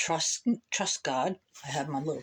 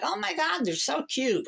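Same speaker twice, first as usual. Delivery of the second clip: mock gushing girls; 10 semitones higher than usual. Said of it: "we were like".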